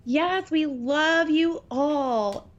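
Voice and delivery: usual speaking voice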